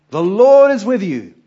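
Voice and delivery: loud, slow proclamation; proclaiming loudly. Acted. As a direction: announce it slowly and loud, like a herald. Angel's greeting to Gideon.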